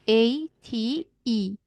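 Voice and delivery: slow letter-by-letter spelling of اي تي اي. Shown as a demonstration. Teacher to pupil, spelling the word letter by letter slowly. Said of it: "عندنا ايت".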